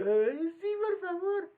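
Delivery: mock whimpering yes from the customer; childlike pleading. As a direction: plead like a spoiled kid. Mock childlike reply to the lady.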